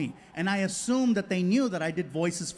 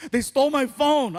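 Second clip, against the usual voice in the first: mock upset outburst, raised pitch; devastated son; 6 semitones higher, 4 dB louder than usual. Son telling dad about the theft, devastated, higher voice. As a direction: imitate a teen blurting bad news. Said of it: "he's all devastated".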